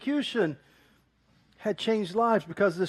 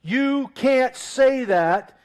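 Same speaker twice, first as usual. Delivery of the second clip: louder, higher and very slow, forbidding; stern ruler; 5 semitones higher, 5 dB louder, much slower than usual. Retold or imitated. Roman powers forbid Christians to speak, stern and slow. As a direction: lay down the law word by word. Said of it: "powers would say to them".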